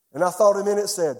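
ordinary talk

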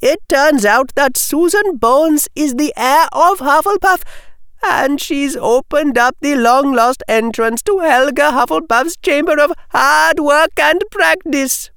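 put-on high girlish character voice; explaining schoolgirl. Schoolgirl explaining Susan is Hufflepuff's heir, high voice. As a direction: play a young witch reporting big news, pitched high.

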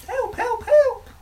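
very high distant screams for help; calling for help. Acted. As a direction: cry out for help in panic. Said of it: "voice saying".

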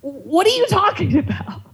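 rising exasperated outburst. Retold or imitated. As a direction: voice climbing high in disbelief. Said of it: "And I was like".

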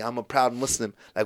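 ordinary delivery

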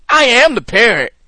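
usual speaking voice

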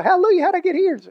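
high-pitched hallelujah exclamation; mock amazed joy. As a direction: play astonished delight, voice leaping up high. Acting out a surprised arrival in heaven.

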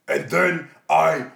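loud, slow monster roar; Hulk voice. Acted. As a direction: bellow it like a brute. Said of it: "The Hulk ... gives it a shot regardless".

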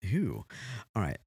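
normal talking voice

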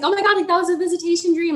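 high, rushed thrilled reaction; excited. Retold or imitated. Boyfriend tells her his dream, excited. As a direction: blurt it out fast and high, thrilled. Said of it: "I was so excited for him".

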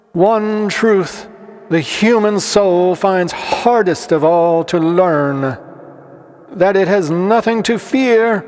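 high, measured sermon intoning; preacher. A narrator's reading. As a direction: preach it in sustained, projected high tones. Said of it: "said the preacher".

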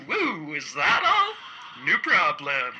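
slow relieved woo; relieved inner thought. A narrator's reading. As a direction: breathe out in relief. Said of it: "while thinking".

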